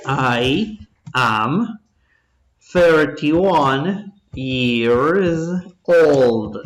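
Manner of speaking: each word drawn out for copying; slow dictation. Shown as a demonstration. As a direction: spell the answer out slowly as you write. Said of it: "A ver la respuesta". (dictado lento)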